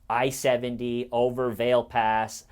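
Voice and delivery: usual speaking voice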